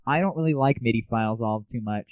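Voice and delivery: normal talking voice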